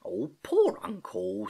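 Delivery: put-on slow, hushed sympathy from a young niece; soothing. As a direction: coo over the hurt uncle, drawn out. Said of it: "soothed Gedankin".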